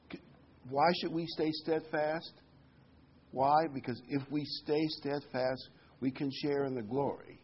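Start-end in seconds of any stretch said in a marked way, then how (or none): none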